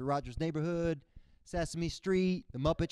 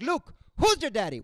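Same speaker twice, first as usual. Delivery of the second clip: high, loud mock Cuban dad; hammed-up Cuban dad voice; dad's botched Vader; 9 semitones higher, 7 dB louder than usual. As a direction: mangle the line proudly. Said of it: "He's like".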